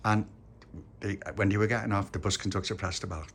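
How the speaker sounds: ordinary delivery, nothing marked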